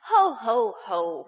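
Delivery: loud booming ho ho ho; roaring laugh. A narrator's reading. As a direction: roar it like a gusting wind. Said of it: "roared the wind".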